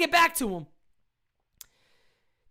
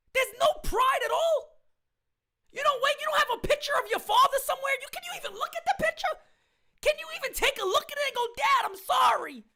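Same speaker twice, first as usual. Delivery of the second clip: rising, fast, raised-voice berating; exasperated rant; 6 semitones higher, faster than usual. Real, not acted. Fan scolding the owner for lacking pride. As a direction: tear into him with disbelief.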